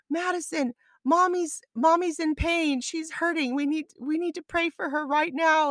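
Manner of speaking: put-on high, earnest little boy voice; child's voice. Son rallying his sister to pray, high and earnest. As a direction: play a ten-year-old rallying the family. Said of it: "He's like".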